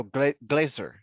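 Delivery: "glazer" slowed and stressed, with a Z, not a C; careful correction. Shown as a demonstration. Correcting a player who heard glacier, careful "glazer". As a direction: say "glazer" slowly with a clear Z. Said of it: "hearing glacier with a C".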